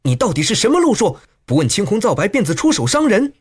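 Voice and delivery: high-pitched scolding woman; sharp rebuke. A narrator's reading. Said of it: "那少妇也喝道".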